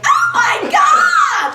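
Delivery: very high, loud, drawn-out squeal; shriek of excitement. Retold or imitated. Hearing the ghost answer her name, long excited squeal. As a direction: squeal like a giddy twenty-two-year-old. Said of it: "And I was like".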